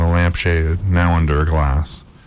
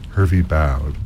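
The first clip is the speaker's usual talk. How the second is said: usual speaking voice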